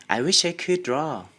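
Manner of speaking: a little schoolgirl wishing out loud; wistful girl. Acted. Girl to Tom over his drawing, wistful and girlish.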